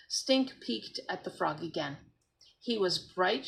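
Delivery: ordinary delivery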